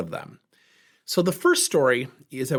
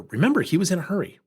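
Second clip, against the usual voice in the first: ordinary delivery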